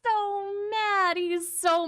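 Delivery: high, drawn-out cries of disbelief; gleeful exclaiming. Real, not acted. Streamer crowing to viewers over a rival seething, gleeful exclaiming. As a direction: crow it high and slow, breaking into laughter.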